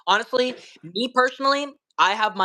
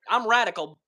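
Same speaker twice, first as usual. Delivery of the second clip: usual speaking voice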